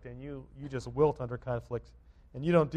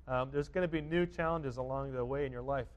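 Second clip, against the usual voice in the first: ordinary delivery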